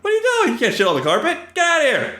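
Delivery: high, shrieking outrage at an intruder; mock outraged yell. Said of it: "You're just like".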